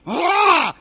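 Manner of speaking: a sudden loud, high frustrated yell; angry yell. Retold or imitated. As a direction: let out a mock outburst as you throw. Throwing socks in a rage.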